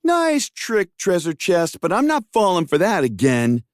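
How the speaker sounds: very high greedy demand; high hero voice. Acted.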